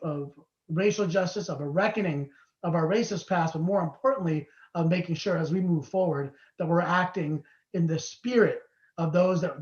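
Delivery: ordinary delivery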